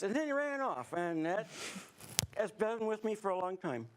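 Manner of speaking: hammed-up Southern yarn-spinner; Southern accent. Camper recalling a little green man, Southern accent. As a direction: spin a tall tale in a Southern accent. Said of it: "keep going with the Southern accent".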